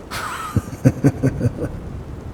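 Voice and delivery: rapid, breathy sinister chuckle; gloating chuckle. Acted. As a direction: chuckle smugly, mouth barely open. Spirit gloats it is inside head.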